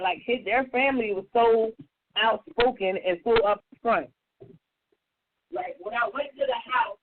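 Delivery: normal talking voice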